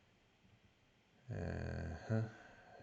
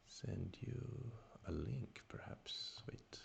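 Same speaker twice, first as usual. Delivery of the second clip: whispering; 3 semitones higher, 12 dB quieter, slower than usual. Real, not acted. Trailing off while playing a move.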